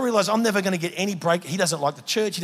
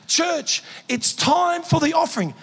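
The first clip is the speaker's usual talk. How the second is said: high-pitched, imitated call to the congregation; pastor's rousing announcement; 9 semitones higher, slower than usual. Indian pastor opens the offering to the church, rousing announcement. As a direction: announce it like a big event.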